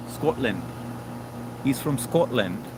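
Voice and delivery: slow Scottish "Scotland" with rounded o; light Scottish imitation; mock Scottish accent. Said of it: "but I love the accent".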